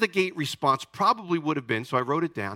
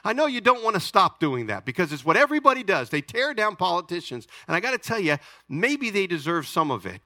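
ordinary talk, nothing marked

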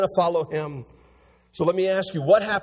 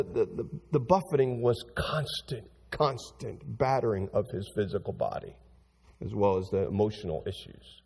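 hushed; 9 semitones lower, 6 dB quieter than usual. Real, not acted. Preacher drops low and soft.

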